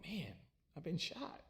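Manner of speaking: stunned, half-whispered realization; shocked disbelief. Retold or imitated. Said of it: "I'm like".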